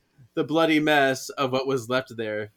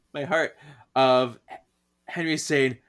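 ordinary delivery

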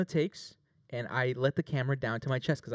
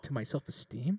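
whispered, hurt question; mock-wounded whisper; 6 dB quieter than usual. Telling the judge the slap hurt his pride, a mock-hurt whisper. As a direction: breathe it like a secret wound.

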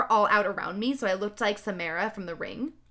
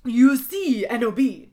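put-on louder, theatrical old wizard voice; a louder take on the wizard; Dumbledore; 2 semitones higher, 8 dB louder than usual. A narrator's reading. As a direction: pronounce it like a grand headmaster. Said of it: "My Dumbledore voice again".

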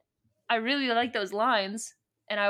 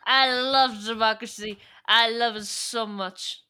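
Palpatine; 6 dB louder than usual. Acted. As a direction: play the scheming emperor, drawing it out. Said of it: "she's very much Palpatining in this moment".

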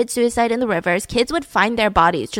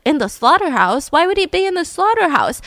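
rising, bewildered questions; puzzled disbelief, pitch climbing high; confused boy; 6 semitones higher than usual. Retold or imitated. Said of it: "he's like".

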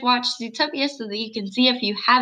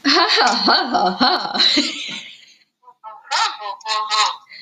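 fake laugh; slower than usual. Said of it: "That's my great fake laugh".

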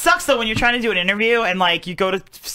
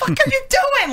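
exasperated. Retold or imitated. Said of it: "I'm like".

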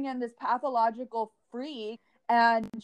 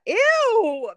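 disgusted ew; 11 semitones higher, 10 dB louder than usual. Real, not acted. Reacting to a photo.

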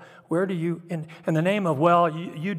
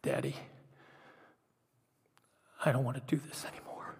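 put-on hushed, breathless little-girl whisper, hurried at the end; frightened whisper; 9 dB quieter, faster than usual. Young daughter to her raging dad, whispering. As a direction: barely voice the words, shrinking away. Daughter's response in the role play.

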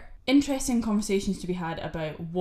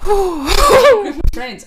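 excited; 13 semitones higher, 15 dB louder, much slower than usual. Real, not acted. Drawn high exclamation.

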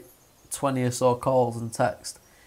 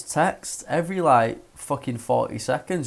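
normal talking voice

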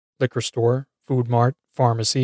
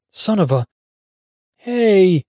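indignant call rising to a high "hey"; tantrum outburst; 7 semitones higher, 3 dB louder, slower than usual. A narrator's reading. Man finding his car ransacked, tantrum outburst. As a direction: call out like a child mid-tantrum. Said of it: "call out with the composure and dignity of a child mid-tantrum".

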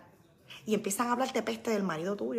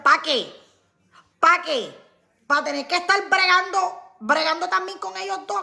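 exasperated; 8 semitones higher, 8 dB louder than usual. Real, not acted. Venting about husband at games.

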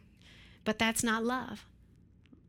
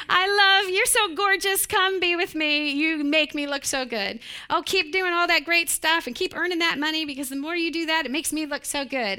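much louder, higher showing-off voice; vain pride; 5 semitones higher, 6 dB louder than usual. Retold or imitated. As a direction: gloat about how good they make you look. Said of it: "Pride is".

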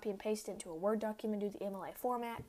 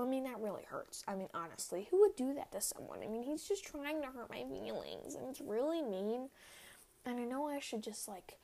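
ordinary delivery

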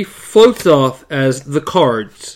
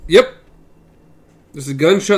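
ordinary talk, nothing marked

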